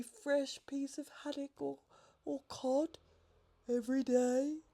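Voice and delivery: a nervous bird asking a favour; halting, humble request; timid pelican. A narrator's reading.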